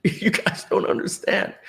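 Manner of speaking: breathy, near-tearless choked words; reliving a tearful goodbye. Retold or imitated. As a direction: push it out through held-back tears. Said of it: "can't speak without crying".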